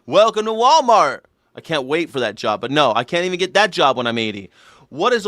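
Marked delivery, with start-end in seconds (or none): 0.1-1.2 put-on higher, louder old greeter calling out; old Walmart greeter. Comedian playing his future self as Walmart greeter, loud old voice. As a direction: hail shoppers like an eighty-year-old greeter. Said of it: "I'm gonna stand in front of a Walmart".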